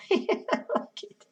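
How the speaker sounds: breathy laugh through the words. Real, not acted. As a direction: giggle the words out. Laughs at the pun.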